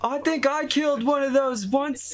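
very high put-on character voice; Tharis's voice. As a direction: play the character in a high, squeaky falsetto. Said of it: "Tharis just goes".

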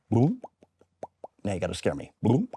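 normal talking voice

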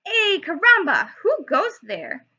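high, loud cry of alarm; sudden startled call; excited cry. Acted. Character calls out in story.

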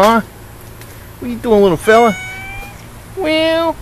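high, cooing voice for the cat. Real, not acted. Owner cooing to his cat Bootsy, high baby talk.

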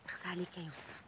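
breathless hushed reproach; whispered reproach. Acted. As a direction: murmur it hurt. Noura reproaching lover. (عتاب هامس)

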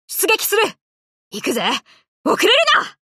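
rally the fleet into battle; sharp sortie command; battle cry. Acted.

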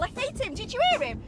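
ordinary talk, nothing marked

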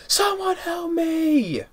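high, drawn-out mock scream; imitated scream. Retelling the hospital scene, a high slow mock scream. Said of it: "the way he screams like that".

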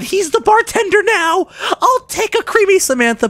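high, loud squealing delight; excited shriek. Real, not acted. Gamer shrieking at a character turned bartender. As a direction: squeal like it's the best news ever. Mock cry at game character.

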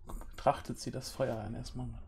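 ordinary talk

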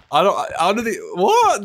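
high, loud disbelief; "what" leaping up high and loud; incredulous outburst. Real, not acted. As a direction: blurt it out, baffled. Baffled by impossible impression request.

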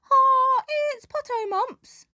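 very high, squeaky shrew with a loud "Ah"; tiny creature shrieking its guess. A narrator's reading. Shrew squeaks its guess at the hippo, very high and squeaky. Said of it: "said Shrew".

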